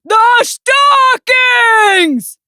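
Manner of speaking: high, loud, very drawn-out shout; exasperated shout. A narrator's reading. Bear hints at stockings to Mouse, exasperated shout. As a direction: bellow it, stretching every syllable.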